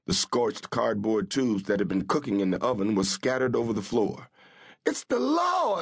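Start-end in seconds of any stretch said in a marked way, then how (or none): 4.8-5.8 very high crying scream; panicked scream as the marshal bursts in. A narrator's reading. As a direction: shriek it in panic. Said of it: "Amabel screamed".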